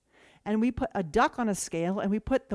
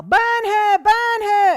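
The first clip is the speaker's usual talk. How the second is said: shouted much higher and louder; mob yell; 12 semitones higher, 14 dB louder, much slower than usual. Retold or imitated. Villagers condemning the witch, hammed-up loud yell. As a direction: roar for her to be burned. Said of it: "So they yell".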